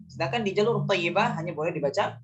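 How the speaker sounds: usual speaking voice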